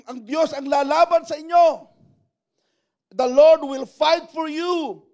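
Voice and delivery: loud, high-pitched declaration; Moses proclaiming. Acted. Moses promising the people God will fight, loud proclamation. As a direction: declare it forcefully to the whole crowd.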